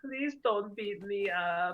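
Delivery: slow, high-pitched mock plea; Cell begging. Cell begging Vegeta for his life, mock pleading. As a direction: drag out a pitiful put-on plea. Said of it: "begging for his life, going".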